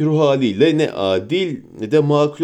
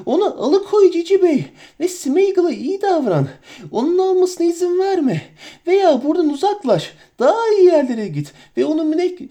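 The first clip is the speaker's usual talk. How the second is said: much higher, pleading creature wail; hit hard crying out; 13 semitones higher than usual. Acted. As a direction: beg the master in a high whine. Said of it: "diye feryat etti Gollum".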